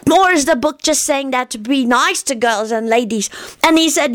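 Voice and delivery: put-on high, earnest childish voice; a little boy explaining things very seriously; young Teddy. A narrator's reading. Narrator voicing Teddy explaining to grown-ups, high childish earnest.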